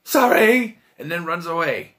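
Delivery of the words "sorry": high, loud, hurried "Sorry!"; hammed-up sheepish yelp while fleeing; mock apology. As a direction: blurt the apology over your shoulder as you bolt. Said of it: "he goes".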